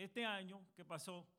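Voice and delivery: ordinary talk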